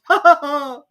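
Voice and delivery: loud high cackle; mad laugh. A narrator's reading. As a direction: cackle madly. Hatter laughs.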